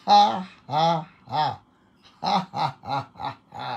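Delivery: spaced ha's, then a falling string; deliberate forced laugh. Shown as a demonstration. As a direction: lead another round of on-purpose laughter. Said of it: "Isa pa, isa pa".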